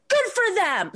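woman in line cheering the coup, mocked high and loud. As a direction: imitate her smug loud approval. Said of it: "the woman behind me goes".

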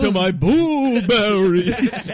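slow, high, loud vampire wail; Count Chocula voice. Shown as a demonstration. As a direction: drag it out like a cartoon vampire. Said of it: "don't do it so Count Chocula-ish".

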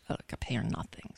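unvoiced joking whisper. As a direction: whisper it as an aside. Joking aside about producer.

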